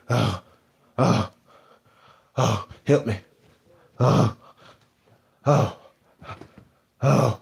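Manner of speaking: faked breathless moans; mock out of breath. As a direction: moan like you can't breathe. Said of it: "he out of breath".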